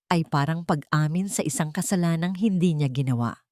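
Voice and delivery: usual speaking voice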